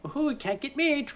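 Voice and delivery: high-pitched, teasing imp voice; mock taunting leprechaun. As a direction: imitate a little creature gleefully taunting its pursuer. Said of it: "he does this, like, little teleport thing".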